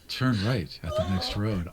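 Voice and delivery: low, sultry navigation voice; sexy GPS voice. Pretend. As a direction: purr the directions like a seductive GPS. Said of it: "talking like a sexy G P S".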